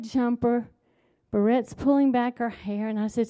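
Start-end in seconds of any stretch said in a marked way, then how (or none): none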